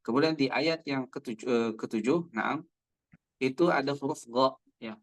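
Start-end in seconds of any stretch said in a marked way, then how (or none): none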